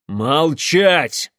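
loud commanding shout; sharp reprimand. A narrator's reading. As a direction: order them to be silent. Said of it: "прикрикнул отец". (строгий громкий окрик)

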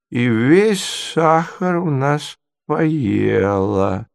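high, very drawn-out, hesitant reminiscing; doddering prince, rambling. Acted. Prince recounts his muddled dream, slow and wandering. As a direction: stretch the words as the memory drifts back.

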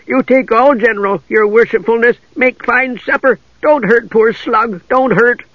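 high, slow pleading creature; drawn-out, high little wretch; sniveling voice. Acted. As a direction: snivel out a humble offer. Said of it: "said the sniveling voice".

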